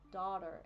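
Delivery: daughter as DAH-der with an R; American pronunciation. Shown as a demonstration. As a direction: open AH and R ending in daughter. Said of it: "Everything is dar".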